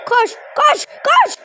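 frantic yell urging him on; shouting. Real, not acted. Urging player while watching match.

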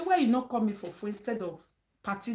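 normal talking voice

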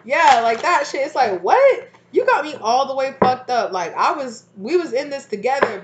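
worked-up outburst. Real, not acted. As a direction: blow up in disbelief. Outraged, worked up about betrayal.

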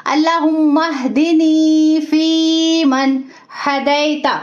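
अल्लाहुम्मा हदिनी फी मन हदैता recited slowly, the दी and फी stretched; recited Quranic dua with long madd vowels; tajweed recitation. Shown as a demonstration. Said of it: "two seconds के लिए खींच के पढ़ेंगे".

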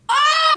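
sharp, very high, loud yelp; pained yelp. Real, not acted. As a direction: yelp as the wax strip rips. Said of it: "that was a sexy sound".

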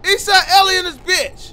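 loud high battle cry; taunting shout. Real, not acted. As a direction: yell the gang call at the enemy. Calling out after a kill.

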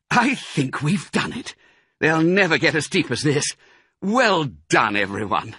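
high-pitched, puffed triumph; relieved Mister Fox. A narrator's reading. Mister Fox praising his tired family, relieved. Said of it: "panting for breath".

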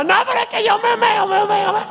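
very high, laughing squeal of a drenched guy; high-pitched giggly rant about wet clothes; laughing soaked Venezuelan. Retold or imitated. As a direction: play it as a joke while dripping wet. Venezuelan insists he'll pee himself.

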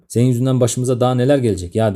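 ordinary delivery, nothing marked

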